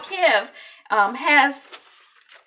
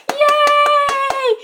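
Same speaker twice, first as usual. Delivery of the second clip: high, loud drawn-out cheer; excited cheer; 7 dB louder than usual. Pretend. Host cheering her first subscribers, excited. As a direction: let out a big squealing hooray.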